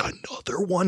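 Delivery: high, hushed amazed question; amazed child. Retold or imitated. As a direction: ask in wide-eyed wonder. Abby amazed at each dwarf.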